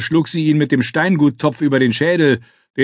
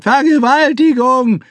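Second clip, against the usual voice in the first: shrill high scream, much louder; 5 dB louder, slower than usual. Acted. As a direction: scream it in outrage. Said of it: "Die Alte schrie sofort".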